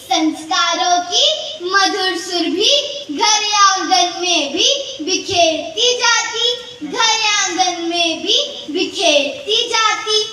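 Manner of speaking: sing-song verse, each line drawn to a cadence; poem recitation. Acted. Reciting a poem on daughters to an audience, sing-song recitation. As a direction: recite in measured, lilting lines.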